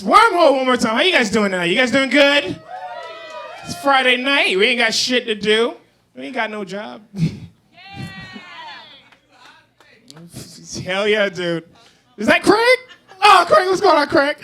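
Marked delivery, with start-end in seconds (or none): 0.0-5.4 loud, higher hype call; crowd shout. Real, not acted. Hyping the crowd. 12.2-14.4 very high loud excited call; very high thrilled greeting. Real, not acted. Spotting a friend in crowd.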